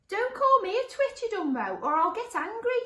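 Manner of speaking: very high, loud, indignant squawk; high-pitched monster losing its temper; fuming monster. Acted. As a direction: fume in a high character voice. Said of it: "fumed the first monster".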